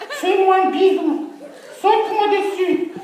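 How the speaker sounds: put-on very high, slow cooing girl voice.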